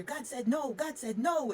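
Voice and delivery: high, loud desperate cry; retold frantic scream. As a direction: scream it to your mother in panic. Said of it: "I'm screaming to my mother".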